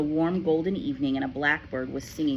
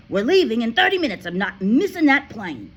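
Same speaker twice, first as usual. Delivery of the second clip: much louder, fast and harsh; angry father; 7 semitones higher, 10 dB louder than usual. A narrator's reading. Father yells at Matilda about the flight. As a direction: rush it out in anger.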